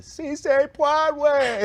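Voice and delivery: very high, slow, loud mock French; sing-song it in an exaggerated French lilt; mock French phrase. Host mocking Jill Biden's French line, high slow mock French. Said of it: "brings it back to".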